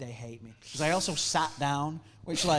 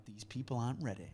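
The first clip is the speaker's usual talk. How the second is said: cocky murmur; 5 semitones lower, 11 dB quieter than usual. Retold or imitated. Cocky thought, much softer, laughing.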